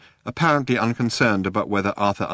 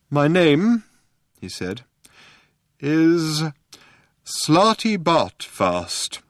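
slow, higher, louder solemn reveal; solemn old man; 8 semitones higher, 3 dB louder, much slower than usual. A narrator's reading. Old man revealing his name to Arthur, slow and solemn. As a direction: unveil the name slowly.

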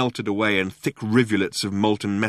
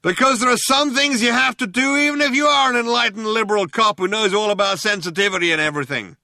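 high, loud shouted explanation; shouting cop; 13 semitones higher, 5 dB louder than usual. A narrator's reading. Enlightened liberal cop justifying the threat, shouting. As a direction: shout it all out at high pitch.

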